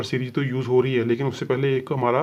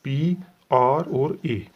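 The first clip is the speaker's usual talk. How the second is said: very slow, letter by letter; spelled out slowly; much slower than usual. Shown as a demonstration. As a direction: spell out पी, आर और ए with long gaps. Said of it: "हम पहले पढ़ेंगे हम हमारे stroke को". (धीरे-धीरे अक्षर बोलना)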